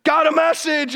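loud, high-pitched mock announcement; startling angel announcement. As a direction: burst in loudly to startle the listener. Acts angel suddenly appearing.

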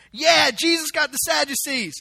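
loud, high, gleeful cheer; imitating a celebrating crowd; high-fiving cheer. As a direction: crow over a rival's defeat. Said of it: "they're still high-fiving".

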